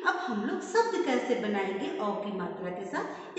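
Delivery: ordinary talk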